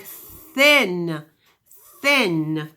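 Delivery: "thin" with a breathy, unvoiced th, tongue between the teeth; voiceless th. Shown as a demonstration. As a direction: say "thin" slowly, air only, no voice on the th. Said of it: "The voiceless sound is the th".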